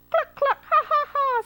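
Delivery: very high, loud cackling hen; clucking laugh. Acted. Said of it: "said the chicken".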